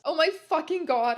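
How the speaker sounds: high, loud mock dismay; sad shout. A narrator's reading. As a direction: cry out with hammed-up distress. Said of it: "I shooted sadly".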